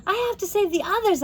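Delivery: high, fast urgent; urgent girl voice. A narrator's reading. Josie tells Anna she must save the others. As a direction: rush it out.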